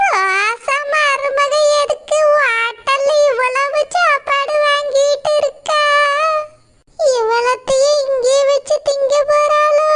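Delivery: put-on high, slow old woman's voice, prying and nosy; old mother-in-law. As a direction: drag out each word like a curious granny.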